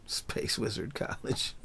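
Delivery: very soft, half-whispered repeat; hushed aside. Real, not acted. Host savouring his own joke name, hushed aside. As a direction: drop it right down, as if to yourself.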